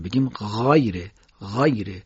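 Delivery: غایره with a soft, stretched غ; Arabic غ in غایره, soft and drawn out; soft drawn-out ghayn. Shown as a demonstration. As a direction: glide the غ softly and long. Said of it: "باید ما غین رو باید نرم و کشیده ادا کنیم".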